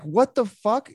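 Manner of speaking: high-pitched incredulous reaction; retold high-pitched bafflement; baffled. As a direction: react in disbelief, pitch jumping up. Retold baffled reaction to counter lady.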